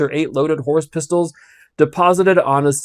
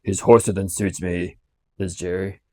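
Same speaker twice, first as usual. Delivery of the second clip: low growl to himself; growled aside; 7 semitones lower, 5 dB quieter than usual. Acted. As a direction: growl it under the breath. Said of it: "growled the guard to himself".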